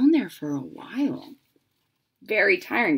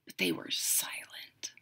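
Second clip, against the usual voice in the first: hushed, breathy storybook whisper; 14 semitones lower, 16 dB quieter than usual. A narrator's reading. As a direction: whisper it as if to sleepy children. Reading a story to children.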